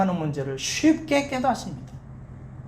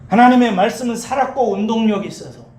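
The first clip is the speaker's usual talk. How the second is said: loud, raised, emphatic preaching; building exhortation; 3 semitones higher, 4 dB louder than usual. Real, not acted. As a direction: drive each repeated phrase home. Preacher builds exhortation to keep asking.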